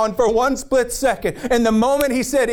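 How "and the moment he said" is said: ordinary talk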